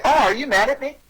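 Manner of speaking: high, mildly startled question; mock concerned surprise. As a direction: ask it as if taken aback. Demonstrates question to someone pushing.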